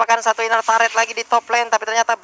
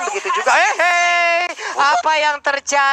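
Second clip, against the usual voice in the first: surprised caster; 5 semitones higher, slower than usual. Real, not acted. Caster reacts to sudden kill.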